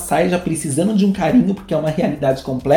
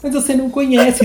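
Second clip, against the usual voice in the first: eager gossip plea; 9 semitones higher than usual. Retold or imitated. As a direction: beg for the gossip, much higher. Acting out eager gossip friend. (pedido ansioso e agudo)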